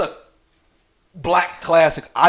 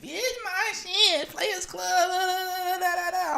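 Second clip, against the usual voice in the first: high put-on voice mocking her, humming the theme; 3 dB quieter than usual. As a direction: sass her back in a high voice, humming the tune. Retorts, mocks movie theme.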